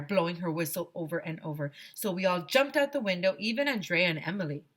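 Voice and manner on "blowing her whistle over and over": usual speaking voice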